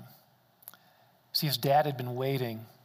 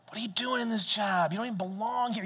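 voice of shame; 5 semitones higher, faster than usual. Retold or imitated. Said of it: "shame was just piling on".

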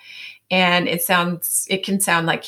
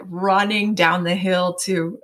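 ordinary talk

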